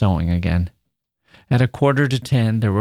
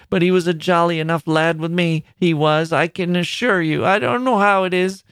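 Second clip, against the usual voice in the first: much higher, monotonous reminiscing grandmother; musing old woman; 6 semitones higher than usual. A narrator's reading. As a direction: ramble fondly about her son. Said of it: "continued to muse aloud".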